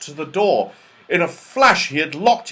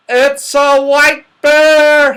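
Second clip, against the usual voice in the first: loud, high, drawn-out alarm; half frightened; 14 dB louder, much slower than usual. A narrator's reading. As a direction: cry out slowly in half-fright. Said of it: "half frightened".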